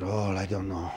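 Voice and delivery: low, soft pontiff voice; mock-solemn Pope, hesitating quietly; reluctant Pope. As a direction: play a doubtful pontiff, low and hushed. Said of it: "His Holiness".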